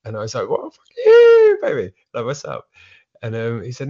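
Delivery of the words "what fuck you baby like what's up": mock outraged yell. Mock outraged retort in retold argument.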